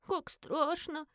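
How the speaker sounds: high, very slow, hushed dread; scared child's exhale. A narrator's reading. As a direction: breathe it out like a frightened little girl. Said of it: "выдохнула Лилу". (испуганно выдохнуть детским голоском, протяжно)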